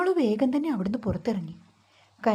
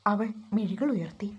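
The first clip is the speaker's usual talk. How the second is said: plain reading voice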